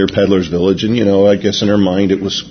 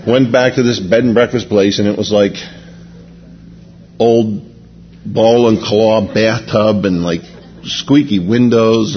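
ordinary delivery

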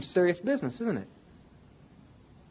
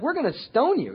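mock threat; 8 semitones higher than usual. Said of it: "from whining to".

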